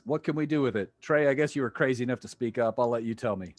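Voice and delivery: ordinary talk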